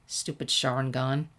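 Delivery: ordinary delivery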